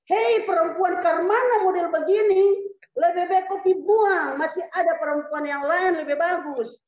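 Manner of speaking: loud, high scolding; hammed-up marital tirade, easing at the end; angry husband act. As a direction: tear into the wife in mock fury. Acted quarrel of eastern husband.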